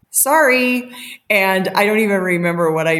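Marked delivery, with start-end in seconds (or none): 0.1-0.9 cheeky sorry. Retold or imitated. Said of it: "And I was like".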